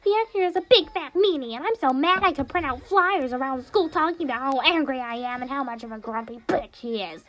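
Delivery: high, pouty cartoon bear ranting about his crush; high whiny bear voice, hurt and angry; Monokuma's high voice.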